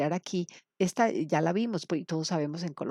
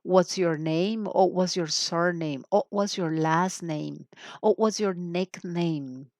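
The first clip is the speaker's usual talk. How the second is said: slow, clear teacher model of each question; slow, careful classroom demo of the questions; slower than usual. Shown as a demonstration. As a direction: say each question slowly for learners to copy. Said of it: "La primera".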